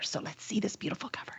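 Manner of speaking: hushed whisper before the reveal; secretive whisper. Real, not acted. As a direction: breathe it to build suspense. Build-up to the cover reveal.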